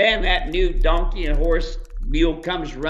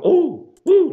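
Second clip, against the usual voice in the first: high, loud startled "Oh, woo" of a spooked animal; skittish horse; 12 semitones higher, 7 dB louder than usual. Shown as a demonstration. Showing listeners a jumpy horse, high loud startled yelp. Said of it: "like this".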